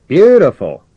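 high, loud, delighted commander; enthusiastic exclamation. Acted. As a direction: call out your praise from below. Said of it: "exclaimed the commander enthusiastically".

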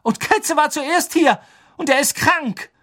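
high, loud, aggrieved protest; indignant parting shot. A narrator's reading. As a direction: throw it out as the angry last word. Ron beendet wütend den Streit. (empört und laut)

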